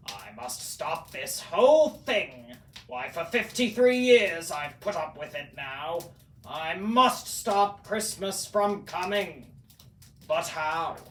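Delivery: loud, exasperated Grinch rant; fed up and loud; fuming Grinch. A narrator's reading. The Grinch fed up with Christmas, loud fuming rant.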